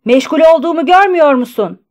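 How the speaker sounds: fast, high, loud scolding question; shouted rebuke. A narrator's reading. Postmaster scolding a waiting customer, shouting. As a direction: yell it impatiently, quick and loud. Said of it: "diye bağırdı".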